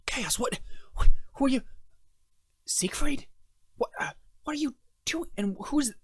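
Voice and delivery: a flustered woman caught off guard; startled NPC woman. Acted. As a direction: voice jumps high in alarm.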